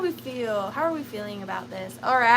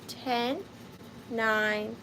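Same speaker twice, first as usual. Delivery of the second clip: ordinary talk, nothing marked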